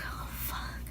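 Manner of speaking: barely audible dread whisper; whispered curse as mom asks for her. Retold or imitated. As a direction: breathe it out in horror.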